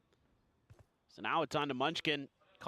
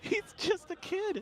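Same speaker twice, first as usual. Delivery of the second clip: laughing; 13 semitones higher, slower than usual. Real, not acted. Laughing at the play.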